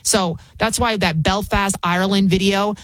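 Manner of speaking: normal talking voice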